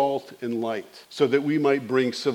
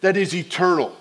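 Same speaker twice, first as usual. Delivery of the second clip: full-force preaching; 6 semitones higher than usual. Acted. Pastor preaching hard to the congregation about eternity.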